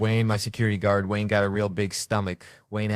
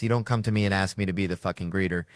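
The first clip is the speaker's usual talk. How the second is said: ordinary talk, nothing marked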